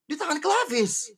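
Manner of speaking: fast, rising play-by-play call; esports caster. Acted.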